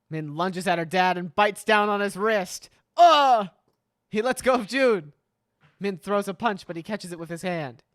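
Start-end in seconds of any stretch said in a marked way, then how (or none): 3.0-3.5 loud sharp grunt of pain; sudden loud pained ugh. Acted. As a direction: cry out as teeth sink in. Father bitten on the wrist.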